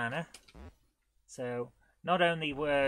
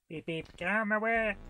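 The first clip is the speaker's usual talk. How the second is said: quick, higher mock car horn; playful honk; 7 semitones higher, faster than usual. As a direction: beep them out of the way. Honking through enemies.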